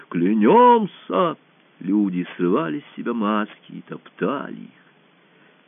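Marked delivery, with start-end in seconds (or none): 0.1-1.4 high, louder, drawn-out oath cry; crowd's oath. A narrator's reading. Crowd repeating its oath of loyalty, calling out. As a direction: let the word ring out across the hall. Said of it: "прокатилось по залу".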